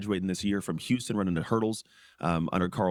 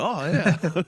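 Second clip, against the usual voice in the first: laughing oh yeah; 9 semitones higher, 5 dB louder than usual. Retold or imitated. Mock reaction to warm snow.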